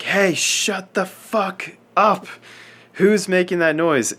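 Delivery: high, slow furious shout; angry yell. Retold or imitated. Director yelling at the lifters, slow angry shout. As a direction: bark down the stairs at whoever is making noise.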